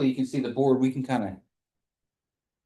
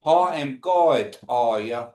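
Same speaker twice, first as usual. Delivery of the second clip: slow demo; slower than usual. Shown as a demonstration. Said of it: "Y'all repeat that for me".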